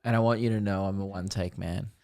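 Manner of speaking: ordinary talk, nothing marked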